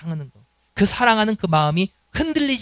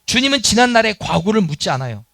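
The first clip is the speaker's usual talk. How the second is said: ordinary talk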